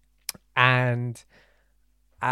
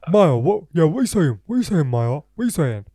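put-on loud, high, drunk-lad voice; mocking impression of Jeevan; 4 semitones higher, 7 dB louder than usual. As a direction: ham up a drunk mate on the phone. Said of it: "this isn't how he sounds".

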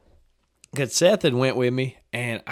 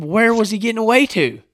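exasperated retort; 10 semitones higher, 7 dB louder than usual. Retold or imitated. Challenging dad after the shot.

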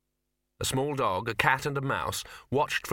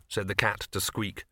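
plain reading voice

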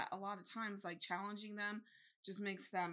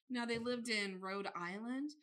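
ordinary talk

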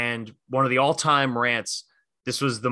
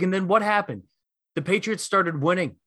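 mildly imitated Philly passion, raised pitch; 6 semitones higher than usual. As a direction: needle the rival with a pointed retort. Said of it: "let his Philly passion jump out".

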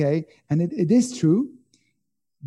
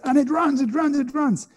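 much higher, urgent repeated runs; urgent, high repetition; 11 semitones higher than usual. Acted. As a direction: pitch it up like a fleeing animal. Acting out fleeing zebra.

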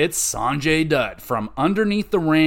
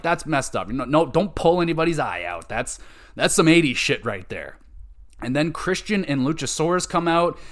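ordinary talk

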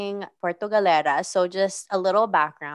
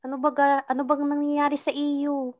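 higher Mindoro Tagalog lilt; Mindoro accent; 7 semitones higher than usual. Shown as a demonstration. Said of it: "so Mindoro accent would be like".

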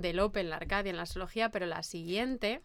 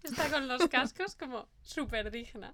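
stifled giggles; 3 semitones higher than usual. Real, not acted. Laughs at Carmen's headphones.

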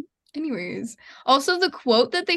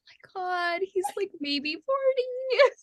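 much higher, slow, drawn-out mock girly gasp; shocked young girls; 8 semitones higher, slower than usual. Lula and Zine gasping over an old man, mock girly voice. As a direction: stretch it out like a scandalized teen. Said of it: "just being like".

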